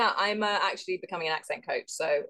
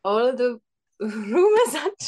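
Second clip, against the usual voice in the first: put-on higher, louder botched Welsh accent; bad Welsh accent; 6 semitones higher, 5 dB louder than usual. Contestant failing at the monologue, giggly bad Welsh accent. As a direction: Welsh accent sliding into Indian, breaking with laughter. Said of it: "cannot not sound Indian doing this".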